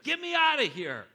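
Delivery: loud, high panicked plea; shouting to be freed; desperate shout. Acted. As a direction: yell it from the cell. Jailed man begs emissary.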